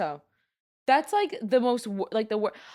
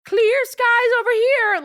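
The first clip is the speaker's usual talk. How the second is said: mock disbelief; 10 dB louder, slower than usual. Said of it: "I'm like".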